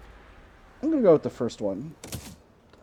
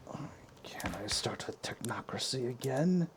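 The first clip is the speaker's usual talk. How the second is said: whisper; 10 dB quieter than usual. Real, not acted. Sudden whispered aside.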